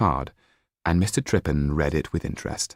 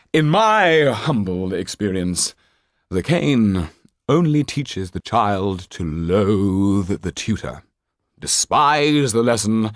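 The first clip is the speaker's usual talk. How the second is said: much higher and louder, grand stage manner; theatrical old actor; 4 semitones higher, 4 dB louder, slower than usual. Acted. Actor Trippin telling the banker about the boy, theatrical old actor. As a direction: declaim like a hammy actor. Trippin orating, slow loud.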